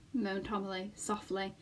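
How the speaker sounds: plain reading voice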